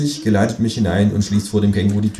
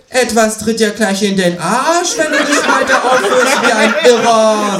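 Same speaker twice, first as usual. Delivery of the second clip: threatening kangaroo; 14 semitones higher, 7 dB louder than usual. A narrator's reading. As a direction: bark a threat.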